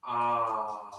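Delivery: long, low exhaled "ah"; "ah" out loud. Shown as a demonstration. Teacher saying it aloud for the class, long low "ah". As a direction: sustain a low "ah" through the exhale. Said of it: "I'll say it out loud".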